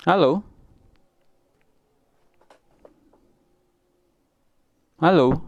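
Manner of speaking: calling out loud. Real, not acted. Calling out on a bad phone line.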